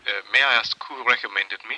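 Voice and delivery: put-on light Viennese accent, quick and polite; a gently Viennese psychiatrist, brisk and courteous. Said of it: "and charmingly Viennese".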